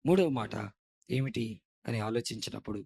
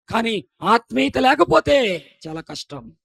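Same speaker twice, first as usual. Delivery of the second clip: louder, higher, slow emphatic; emphatic climax; 10 semitones higher, 13 dB louder, slower than usual. Acted. Preacher stressing family love, slow emphatic climax. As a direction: hit it slowly and hard. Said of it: "loud take then quiet echo".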